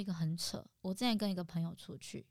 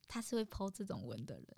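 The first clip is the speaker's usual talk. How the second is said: ordinary talk, nothing marked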